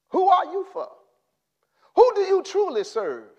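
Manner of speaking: normal talking voice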